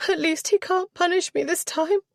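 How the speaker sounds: put-on high, sobbing-edged girlish voice; half-sob it out; tearful girl. A narrator's reading. Girl relieved her father cannot punish her, tearful. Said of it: "drew in a sobbing breath".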